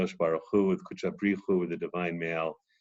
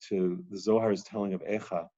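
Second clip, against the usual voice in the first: normal talking voice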